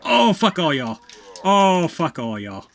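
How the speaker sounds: loud, drawn-out frustrated swearing; exasperated cursing. Real, not acted. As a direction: curse the whole lot loudly. Curses at game enemies.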